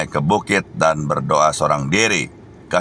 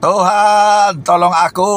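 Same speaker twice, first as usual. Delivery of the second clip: much higher, louder, pleading shout; desperate cry for help; 7 dB louder, slower than usual. Acted. Sinking Peter cries out to Jesus to save him, shouting. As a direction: cry out like a man sinking in the waves. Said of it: "Lalu Petrus berteriak".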